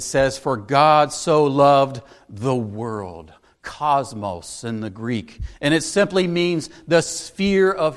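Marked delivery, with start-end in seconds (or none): 0.4-3.3 slow hushed quoting of "the world"; slow, hushed stress on world. Shown as a demonstration. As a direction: draw out "For God so loved the world". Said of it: "notice the object of God's love".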